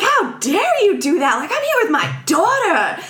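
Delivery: high, indignant diva outburst; mock outraged yell. Imitating famous mother blowing up at manager, mock outraged. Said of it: "is yelling".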